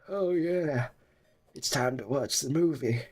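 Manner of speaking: mock-announce it like a returning streamer, only lightly voiced; playful half-hearted put-on voice; different voice. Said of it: "It's hard to do a different voice while sick".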